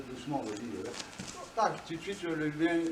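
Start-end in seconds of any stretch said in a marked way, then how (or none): 0.1-0.9 dropped low and soft, an aside to the partner; quietly cue the partner to go; hushed cue to a training partner. Real, not acted. Coaching partner mid-demonstration.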